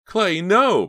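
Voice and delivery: high, loud plea; desperate scream; horrified cry. A narrator's reading. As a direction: cry out to stop him, stretching the last word. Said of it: "Pam cried".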